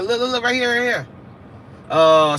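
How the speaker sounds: usual speaking voice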